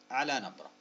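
normal talking voice

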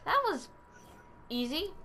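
high start, pause, then rising "easy"; surprised, halting "That was... easy"; pleasantly surprised. Real, not acted. Reacting to quick success before button quote.

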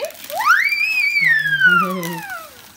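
long, very high, loud squeal. Real, not acted. Child screams over a new present, delighted squeal. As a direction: shriek with delight and hold it. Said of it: "Miren la gritadera".

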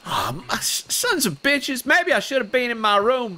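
a cheerful roleplayed cowboy; Enrique character voice. Enrique greeting his gang in game, lightly put-on.

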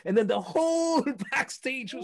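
loud, high and thrilled; telling the flag moment with goosebumps; excited. Real, not acted. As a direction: build up to the big reveal. Excitedly retelling U2 concert climax.

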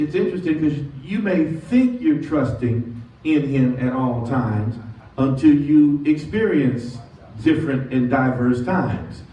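ordinary talk, nothing marked

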